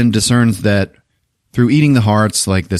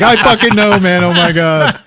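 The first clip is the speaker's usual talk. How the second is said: high, loud, acted helpless laughter; laughing hard; 11 semitones higher, 5 dB louder than usual. [name] cracking up at his own name, high laughing outburst. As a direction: imitate talking through a laughing fit. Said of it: "starts laughing even harder".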